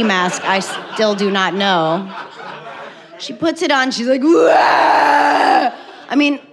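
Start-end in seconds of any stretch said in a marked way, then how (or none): 4.2-5.7 loud high wah, hammed-up scare; mock monster roar. Sister in ski mask scaring.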